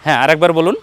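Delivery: ordinary talk, nothing marked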